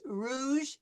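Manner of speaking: "rouge" with an English R at the front palate. Shown as a demonstration. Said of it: "If I'm saying it in English".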